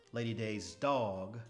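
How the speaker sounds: ordinary delivery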